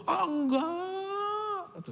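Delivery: very high, drawn-out whiny "Ah, enggak"; mock wailing denial; high-pitched denial. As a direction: squeal the denial up high and slow. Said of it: "Makin tinggi nadanya".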